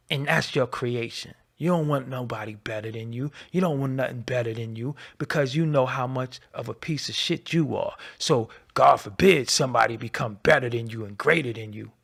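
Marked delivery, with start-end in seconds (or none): none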